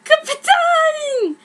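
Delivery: high, loud excited yell; screaming. Acted. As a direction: shriek it up from below. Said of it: "screamed someone below me".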